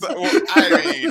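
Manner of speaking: talking through laughter; laughing. Real, not acted. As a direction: giggle out the words. Laughing while speaking.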